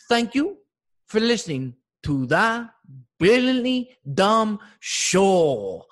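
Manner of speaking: put-on a loud, high, slow take on the sign-off, with an accent; imitating Poppy. Comic imitating landscaper Poppy saying the outro. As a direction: mimic the gardener parroting the outro. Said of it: "He goes".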